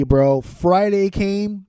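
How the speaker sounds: ordinary delivery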